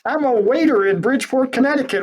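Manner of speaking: high, loud, exasperated mock protest; protesting ordinary people. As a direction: play a flustered nobody objecting to a huge mission. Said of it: "And these people go".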